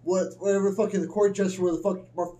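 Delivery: usual speaking voice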